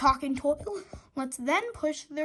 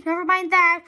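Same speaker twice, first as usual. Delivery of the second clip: a louder brushing-off call; cried; 7 dB louder than usual. A narrator's reading. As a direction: cut Harold off urgently. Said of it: "cried George".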